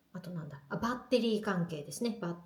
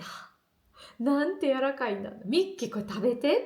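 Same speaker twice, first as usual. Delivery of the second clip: delighted gasp; 5 semitones higher, 4 dB louder than usual. Retold or imitated. Retelling her own delight at bread.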